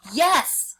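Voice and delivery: high, loud roared yes. A narrator's reading. Tiger roars at the jackal about the gate, loud roar. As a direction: bark out a furious yes. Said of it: "roared the tiger".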